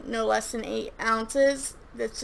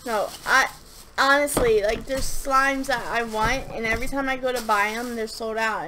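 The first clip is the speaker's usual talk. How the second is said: ordinary talk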